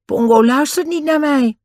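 put-on high-pitched boy's voice; cool reply, pitched well up; unruffled Dick. A narrator's reading. Dick answers the attacked Den, high and unruffled. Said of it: "die rustig bleef kijken".

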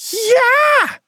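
very high squealed cheer; long, high jubilant squeal; shrieking. A narrator's reading. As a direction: shriek with joy in a tiny voice. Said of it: "gilde Pliny".

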